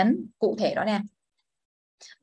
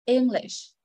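English with the first syllable prominent; stress on first syllable. Shown as a demonstration. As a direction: lift ENG and let lish drop in English. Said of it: "Giảm xuống huyền nặng".

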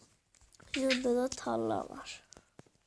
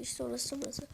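normal talking voice

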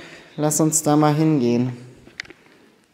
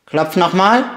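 loud challenge; 4 semitones higher, 10 dB louder, slower than usual. Real, not acted. Daring the ghost to knock.

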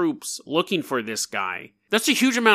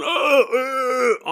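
dying moans; 8 semitones higher than usual. Pretend. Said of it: "the heads going".

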